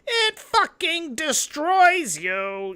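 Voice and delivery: very high, drawn-out climax; slow high-pitched dramatic outburst; exaggerated high wail. Real, not acted. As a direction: stretch it out high, overwhelmed for effect. Comic climax about consciousness videos.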